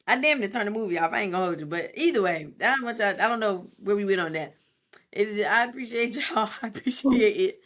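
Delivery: usual speaking voice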